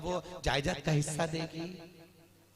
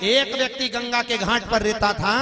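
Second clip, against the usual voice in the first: loud, higher sermon delivery; fiery preaching; 7 semitones higher, 12 dB louder than usual. Acted. Storyteller preaching to the Ujjain women, loud and high. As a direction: proclaim it to the whole crowd.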